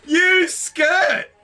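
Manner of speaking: taunting shout. Real, not acted. Yelling taunts at the screen.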